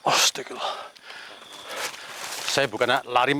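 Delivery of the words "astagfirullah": breathless whispered astagfirullah; whispered prayer. Real, not acted. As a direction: whisper it in fear. (bisikan doa ketakutan)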